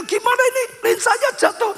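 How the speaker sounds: high-pitched agitated patient complaint; mock alarm. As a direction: act out an upset patient challenging the doctor. Patient confronts doctor over fallen lens. (keluhan pasien yang panik, bernada tinggi)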